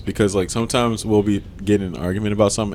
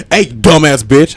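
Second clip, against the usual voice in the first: slow, loud insult; mock aggressive greeting; 2 semitones higher, 6 dB louder, much slower than usual. Exaggerating how partner greets him, slow aggressive insult. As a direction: stretch it out loud and abrasive. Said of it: "It's like she tried to".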